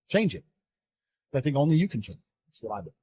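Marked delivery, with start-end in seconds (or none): none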